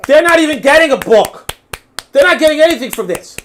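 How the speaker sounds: loud, high-pitched exasperation; exasperated outburst. Real, not acted. Host ranting about people paying for nothing, exasperated outburst. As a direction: raise the voice in disbelief.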